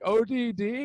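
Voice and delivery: lightly voiced confused echo; confused old man. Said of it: "the guy's like".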